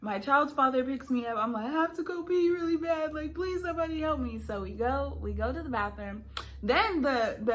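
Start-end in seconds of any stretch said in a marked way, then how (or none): 1.6-4.4 higher, mildly whiny mock plea; desperate plea, imitated. Labouring woman to her child's father, imitated desperate plea. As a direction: act out needing help badly.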